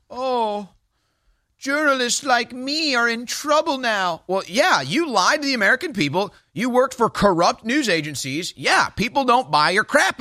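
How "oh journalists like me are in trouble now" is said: higher, slow, whiny mock of a journalist; mocking whiny quote. Host parodying Taylor Lorenz, mock whiny lament. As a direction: parody her self-pity in a put-on voice. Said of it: "She says".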